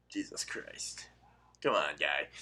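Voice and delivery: breathy exasperated whisper; whispered exasperation. Real, not acted. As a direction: whisper it to yourself. Exasperated aside.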